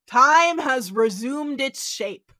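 slow, loud, portentous delivery; mock-epic proclamation; grand quotation. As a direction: intone it grandly, drawing out each word. Intones the script's final line.

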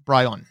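"Brian" said as bry-ON; corrected pronunciation. Shown as a demonstration. Game master correcting the lieutenant's name, "Brian" said as bry-ON. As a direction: give "Brian" a full "on" ending. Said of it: "B R Y O N".

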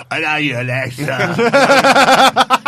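loud, slow, exaggerated Cockney croon; over-the-top Cockney villain, drawn out and loud; hammed-up Cockney. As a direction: ham up a Cockney "I love you, Alexa". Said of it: "Just full Sweeney Todd".

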